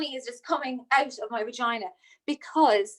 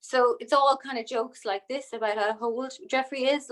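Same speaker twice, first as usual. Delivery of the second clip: usual speaking voice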